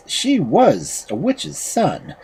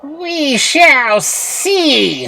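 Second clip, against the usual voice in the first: high, very drawn-out witch voice; menacing witch; 4 dB louder, much slower than usual. A narrator's reading. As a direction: stretch it out as a witch's threat. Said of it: "said the witch".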